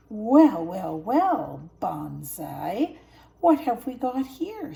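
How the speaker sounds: loud menacing taunt; sneering hyena. A narrator's reading. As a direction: savor the catch. Hyena taunts trapped cubs.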